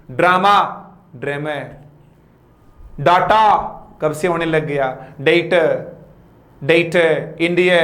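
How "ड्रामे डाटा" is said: ordinary talk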